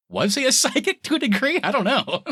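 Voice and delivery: laughing wonder. Real, not acted. Laughing uncertain question.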